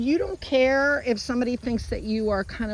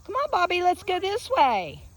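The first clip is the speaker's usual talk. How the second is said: higher, upbeat coaxing voice; high encouraging inflection; 6 semitones higher than usual. Shown as a demonstration. Trainer leading Bobby away, high encouraging voice. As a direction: lift the pitch to jolly a dog along. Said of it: "you wanna talk to him this way".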